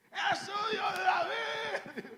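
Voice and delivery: high, drawn-out full-throated scream; gritando más fuerte. Acted. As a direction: bellow the plea so it cannot be ignored. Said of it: "comenzó a gritar más fuerte". (grito desgarrado, agudo y muy alargado)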